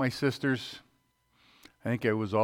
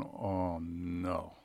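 quiet dread; 5 semitones lower, 8 dB quieter than usual. Retold or imitated. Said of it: "And I'm going".

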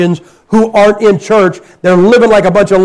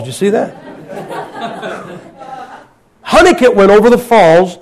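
laughing at a slip. Real, not acted.

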